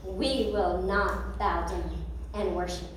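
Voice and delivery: slow, quiet, low and firm; calm defiance. Acted. Three men answering the angry king, quiet and firm. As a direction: quietly refuse the king, resolute.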